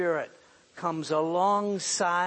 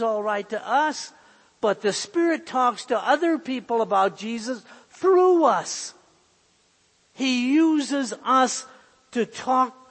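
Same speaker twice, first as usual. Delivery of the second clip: higher and louder, hammering each phrase; emphatic preaching; 6 semitones higher, 6 dB louder than usual. Acted. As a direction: drive it home from the pulpit. Preacher stressing witnessing.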